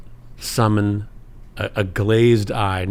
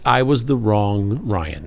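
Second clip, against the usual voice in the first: usual speaking voice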